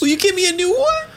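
put-on very high hopeful child; small kid asking for a new toy; little boy voice. Son asks father for toy.

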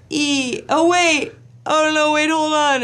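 high, loud dawning alarm; mock panic. Wife spotting her tattoo misspelling, panicked. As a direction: cry out on spotting a blunder.

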